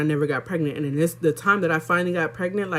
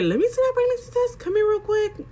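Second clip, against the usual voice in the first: much higher mock friend voice, demanding; imitating her friend. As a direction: squeak it up high as the pushy friend asking for the test. Friend voiced in retold story.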